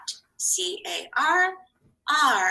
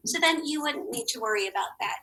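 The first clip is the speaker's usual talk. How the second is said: ordinary delivery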